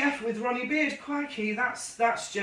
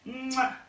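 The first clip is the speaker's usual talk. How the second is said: blown kiss; 3 semitones higher than usual. Real, not acted. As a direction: blow the listeners a smacking kiss. Blows a kiss to viewers.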